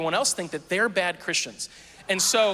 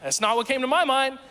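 ordinary talk

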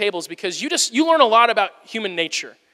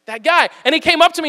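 normal talking voice

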